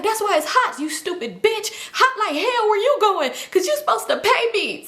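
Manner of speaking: high-pitched mock tirade; high, scolding impression of an angry friend; retold yelling. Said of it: "she yelling at him".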